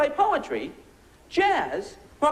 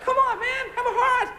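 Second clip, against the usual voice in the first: higher mock pleading; sarcastic plea for the dolphin; 8 semitones higher than usual. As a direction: plead it like a fake bleeding heart.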